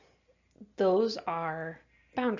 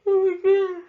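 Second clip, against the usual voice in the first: words stretched out by a big yawn, much higher and louder; 12 semitones higher, 12 dB louder than usual. Real, not acted. Teacher yawning mid-lesson, apologizes after, yawning. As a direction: yawn right through the words. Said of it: "I'm so sorry for all the yawning".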